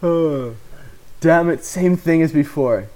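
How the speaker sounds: plain reading voice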